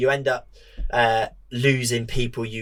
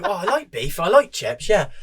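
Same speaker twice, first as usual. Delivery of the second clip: mimicked friend's reply; 7 semitones higher than usual.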